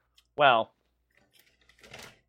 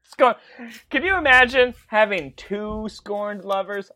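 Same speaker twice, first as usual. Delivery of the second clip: ordinary talk, nothing marked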